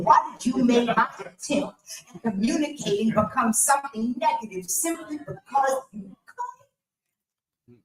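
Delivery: normal talking voice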